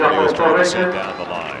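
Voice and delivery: excited call. Real, not acted. Live call of the point ending.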